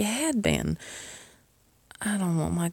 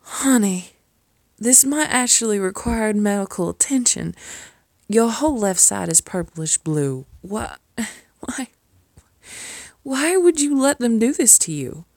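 loud, alarmed, higher-pitched worry; alarmed outburst; 4 semitones higher, 7 dB louder than usual. Acted. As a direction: burst out in shocked concern over the bruises. Alarmed over the bruises.

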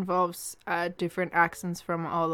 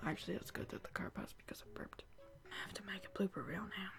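whispering; 14 dB quieter, faster than usual. Real, not acted. Aside about burping.

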